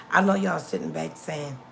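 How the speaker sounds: ordinary delivery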